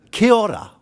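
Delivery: loud, high, proud Kia ora; demo Maori greeting. Shown as a demonstration. Kiwi speaker teaching a Canadian union audience, loud demo. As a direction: call out Kia ora for the room to repeat. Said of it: "would you please repeat after me".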